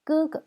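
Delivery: "Ge" high, then a short light "ge"; neutral tone. Shown as a demonstration. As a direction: keep the second "ge" brief and unstressed. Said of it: "Ça se prononce léger".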